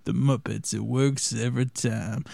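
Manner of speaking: low, slow suave voice; a hint of a smooth ladies' man; Billy Dee Williams. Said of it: "Billy D. Williams".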